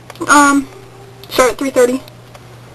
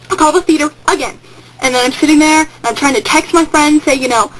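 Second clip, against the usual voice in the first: ordinary talk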